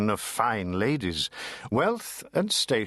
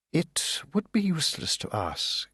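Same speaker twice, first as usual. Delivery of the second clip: lightly voiced haughty lady; 6 semitones higher than usual. Lady Dedlock voiced in reading.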